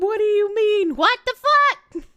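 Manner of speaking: usual speaking voice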